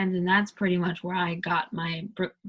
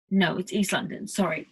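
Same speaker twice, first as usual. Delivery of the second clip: usual speaking voice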